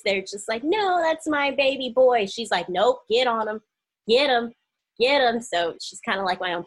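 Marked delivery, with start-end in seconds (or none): 0.6-2.3 mock doting mother. Mocking a doting mother-in-law. 2.7-5.4 louder, emphatic hype-man push; egging on. Retold or imitated. Voicing the supportive mother-in-law.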